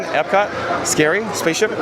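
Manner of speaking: normal talking voice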